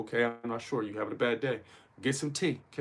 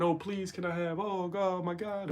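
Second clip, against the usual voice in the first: mock begging, higher-pitched; pleading prayer; 6 semitones higher, 4 dB quieter, slower than usual. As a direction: plead to God in a mock high voice. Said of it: "instead of praying".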